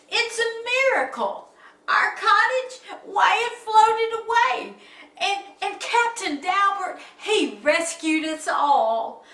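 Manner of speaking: high, loud excited telling; amazed. Acted. Gertie telling Jubal of rescue, high excitement. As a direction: marvel at the rescue.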